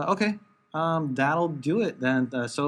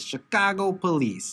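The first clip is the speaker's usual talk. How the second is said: slow mock Chicago accent; 6 semitones higher, slower than usual. Co-host quoting a joke about a mustache, mock Chicago accent. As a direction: lightly put on a Chicago accent. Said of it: "Chicago accents".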